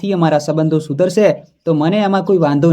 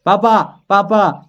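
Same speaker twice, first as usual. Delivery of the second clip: a raised call across the house, falling at the end; calling out loudly; 4 semitones higher, slower than usual. A narrator's reading. Daughter calling for her father after hanging up on her husband, raised call. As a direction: call for papa, a bit louder and higher. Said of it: "જોરથી બૂમ પાડી".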